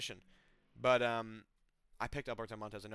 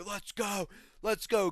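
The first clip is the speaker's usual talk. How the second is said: shouted let's go, higher and louder; mock fighter hype yell; retold yelling; 6 semitones higher, 4 dB louder, slower than usual. Retold fighters screaming at each other.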